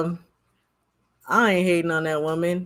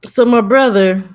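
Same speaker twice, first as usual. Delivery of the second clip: loud announcement; 8 dB louder than usual. Real, not acted. Streamer talking loud to chat.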